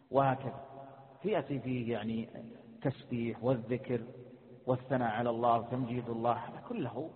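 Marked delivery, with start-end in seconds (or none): none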